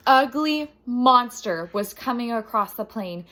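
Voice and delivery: plain reading voice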